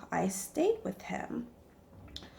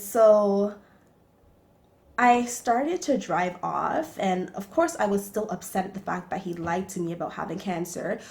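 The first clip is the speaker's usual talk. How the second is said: normal talking voice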